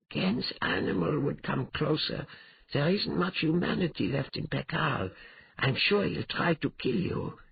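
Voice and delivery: high-pitched female cat character, warning; worried cat companion. Acted.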